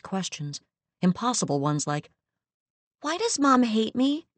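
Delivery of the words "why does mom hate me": put-on higher, small child asking; lightly voiced young girl; childlike voice. Child Eunice's questions.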